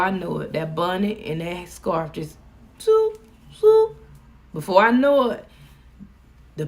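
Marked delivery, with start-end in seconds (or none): 2.8-4.0 a sudden high loud whoosh; high swooping sound effect. Retold or imitated. Describing her bonnet going on, a high loud swoop.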